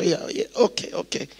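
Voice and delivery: small, soft reluctant agreement; meek acquiescence. Acted. Young drummer answering old churchgoers who complain, meek and hushed. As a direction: give in quietly, fading away.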